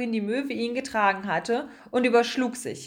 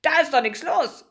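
high, louder mocking gull voice; laughing seagull; 6 semitones higher, 5 dB louder than usual. A narrator's reading. Seagull scoffs at the mouse's travel plan, mock amusement. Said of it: "Sie sprach sehr schnell und schüttelte sich dabei vor Lachen".

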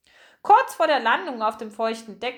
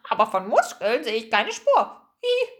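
cheeky seagull; 3 dB louder than usual. Acted. Gull eyes the three doubtfully, cheeky squawk. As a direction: tease them, finish with a gull's screech.

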